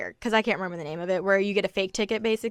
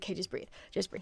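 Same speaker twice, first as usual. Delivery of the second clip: much softer, fast, breathy self-calming; 12 dB quieter, faster than usual. Retold or imitated. Calming herself in the bathroom, very soft. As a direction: hush yourself down, fast.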